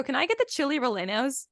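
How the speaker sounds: chili rellenos read as English, "chilly ro-LEN-ohs"; anglicized pronunciation. Shown as a demonstration. Ordering like a total gringo, anglicized pronunciation. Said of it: "I also don't go".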